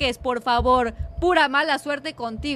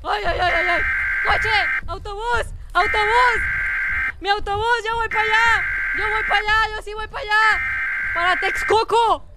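high, frantic cries while fleeing; panicked yelling; 6 semitones higher than usual. Real, not acted. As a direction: shout in a playful panic, pitch pushed up.

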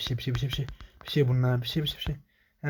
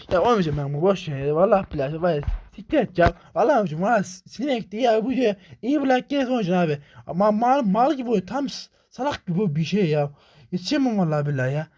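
furious rant; 5 semitones higher than usual. Real, not acted. Gamer rages at the clumsy game, furious. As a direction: rage at the game, voice climbing.

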